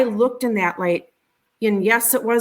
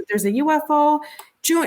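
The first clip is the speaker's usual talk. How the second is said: retold scream; 6 semitones higher than usual. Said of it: "He's screaming for my mother".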